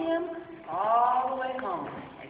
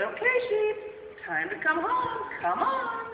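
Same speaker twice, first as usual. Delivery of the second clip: shepherd calling; 8 semitones higher, 3 dB louder than usual. Acted. Shepherd calling sheep home.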